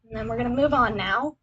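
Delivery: ordinary talk, nothing marked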